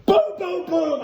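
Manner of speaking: high, loud, punchy exclamations; explosive mock impact noises; shouted punch sounds. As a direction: shout each one like a landed blow. Voices his own punches landing.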